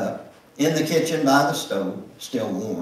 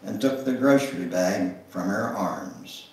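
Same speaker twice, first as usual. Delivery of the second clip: usual speaking voice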